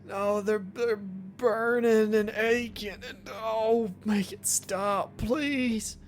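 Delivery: pained, tearful patient blurting out his guilt; distraught injured patient. Acted. Billy confesses hitting his father to the doctor, tearful and pained. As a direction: plead through the pain, half crying.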